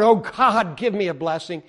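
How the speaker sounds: loud cry to God, easing off; acted shouted plea in prayer; shouting out. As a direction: call out pleadingly to the Lord. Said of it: "He's shouting out to the Lord".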